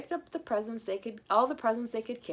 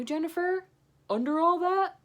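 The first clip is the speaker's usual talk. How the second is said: high, loud surprised question; 10 semitones higher, 6 dB louder than usual. Acted. Santa surprised to find Jennifer in the uniform. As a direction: sound amazed to recognize her.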